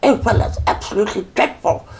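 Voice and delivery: high, posh royal voice with a laugh; mocking the Queen. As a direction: send up the Queen's prim, plummy speech. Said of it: "Who even talks like that?".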